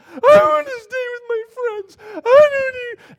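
put-on high, loud, tearful child screaming; crying scream. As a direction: wail it through sobs. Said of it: "she starts screaming".

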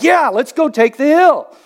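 rally the troops for a charge; fight mode. Acted. Fighter type responding to a yell, rallying cry. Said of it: "You kind of fight".

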